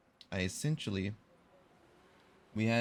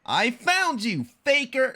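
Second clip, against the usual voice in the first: Ryan Drummond Sonic; 10 dB louder than usual. Shown as a demonstration. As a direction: call out the faker like the hedgehog hero. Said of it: "my best Ryan Drummond Sonic impression".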